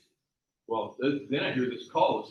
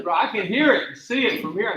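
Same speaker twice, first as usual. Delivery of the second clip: louder, higher mock protest; raised retort; 6 semitones higher, 8 dB louder than usual. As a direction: fire it back, a bit louder. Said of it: "She goes".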